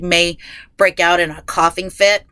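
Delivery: ordinary talk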